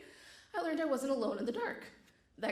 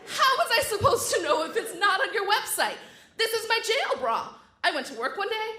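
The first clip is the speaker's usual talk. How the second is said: frantic outburst; 9 semitones higher, 9 dB louder than usual. Retold or imitated. Pleading at the hot guard with a bra in her teeth, frantic and loud. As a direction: let eight years burst out at once. Everything wells up, can't stop.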